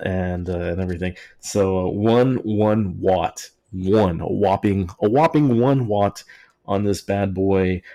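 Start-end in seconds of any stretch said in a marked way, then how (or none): 1.9-6.2 oversell the one watt sarcastically; mock-grand stress on whopping; mock emphasis on whopping. Mocking the tiny power.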